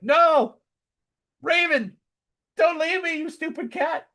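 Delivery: very high-pitched, hammed-up plea; mock desperate pleading. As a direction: beg the cat in pretend anguish. Mock pleading with his dying cat.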